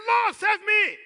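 high-pitched shout for help; Peter's desperate cry. Acted. Expert fisherman begs Jesus to save him, shouting high and loud. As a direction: call out loudly to be rescued. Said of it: "He said".

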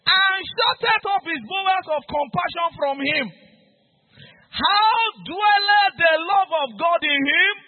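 rising preacher cry, climbing to the end; preaching at full force. Acted. Preacher thundering scripture at the congregation, shouting.

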